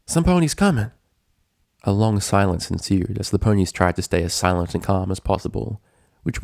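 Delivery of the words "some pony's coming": high, tense alert; higher, anxious; hushed warning. Acted. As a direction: warn them to freeze. Warning in closet.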